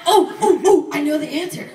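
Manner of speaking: hammed-up excited interjection; mock eagerness. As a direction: play the overeager kid who knows it. Acts eager bystander to conversation.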